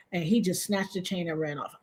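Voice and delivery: usual speaking voice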